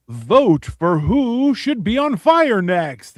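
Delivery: slow, loud game-show voice; dramatic announcer. Pretend. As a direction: stretch it out like a host. Dramatic announcer-style reveal.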